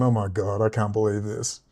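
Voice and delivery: low, soft stunned disbelief; retold disbelief. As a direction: drop pitch and volume, as if stunned. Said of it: "I'm there just going".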